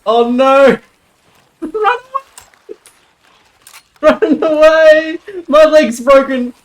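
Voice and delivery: high, loud yelps of mock terror; panicked shrieking. Real, not acted. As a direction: shriek and flee from the snake. Snake attacks in game.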